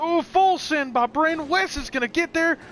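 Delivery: high, loud, thrilled play-by-play; excited race call. Real, not acted. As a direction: yell the pass as it happens. Live race call, cars passing.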